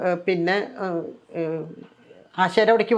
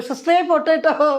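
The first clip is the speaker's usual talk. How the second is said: calling out; 8 semitones higher than usual. Retold or imitated. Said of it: "ആ ഒരു വിളി".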